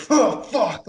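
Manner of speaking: sharp, high, loud mock outburst; imitating a raging curse. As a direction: spit it out like a man losing his temper. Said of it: "like, raging".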